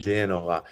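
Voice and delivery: "Genova" in a Genoese lilt; Genoese accent. Shown as a demonstration. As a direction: say "Genova" as the Genoese do, soft and dropped. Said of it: "they don't say Genoa, they say".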